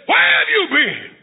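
high, scolding demand; cross sister. Acted. Sister confronting brother outside, cross. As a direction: snap it out high, as a frowning sister. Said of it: "She had a frown".

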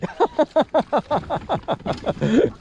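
long, high-pitched laugh; hearty laugh. Real, not acted. Amused after friend's catch.

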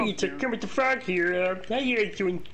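chirpy puppet greeting; high Kermit voice; Kermit impression. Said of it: "you do Kermit the Frog the best".

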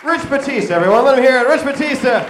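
loud hype-man callout; hype shout. Real, not acted. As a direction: pump the crowd up for him. Hyping a friend to crowd.

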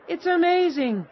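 high, loud burst of wonder; amazed exclamation. A narrator's reading. Woman praising the travelers' soup, amazed.

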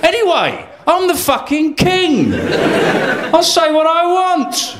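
King Harold shouting down a prim nobleman; outraged king shout. Acted. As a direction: bellow back in outrage.

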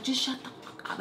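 high, hushed exasperated snap; mock annoyed. Annoyed cousin silences the little girl, high mock exasperation. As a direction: imitate a cousin who has had enough. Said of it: "she was just annoyed".

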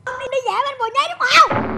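high, loud accusing mother. Retold or imitated. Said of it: "má con nói gì với ba con không". (nhại giọng má tra hỏi ba, cao và to)

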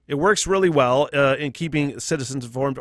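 ordinary delivery

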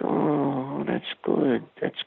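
very soft, hushed imitation; Robert De Niro. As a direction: trail down to a near-whisper, imitating a quiet talker. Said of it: "he talked so soft".

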